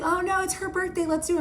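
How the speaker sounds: high-pitched, breezy imitation of a boyfriend; imitated breezy insistence. Boyfriend waving away the girlfriend's objection to buying shots, high breezy insistence. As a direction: brush off the objection in a lifted, high voice.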